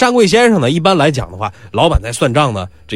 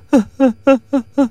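fake sob; 6 semitones higher than usual. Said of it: "哭起来了".

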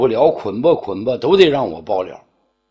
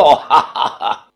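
slow, scornful ha-ha-ha; a drawn-out sneering laugh; contemptuous laugh at the accuser; cold laugh. A narrator's reading. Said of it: "只是一声冷笑".

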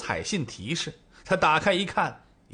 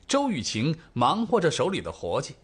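ordinary delivery

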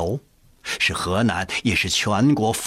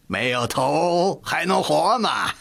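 mocking jeer; 7 semitones higher than usual. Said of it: "阿贵大声讥讽着，笑着说".

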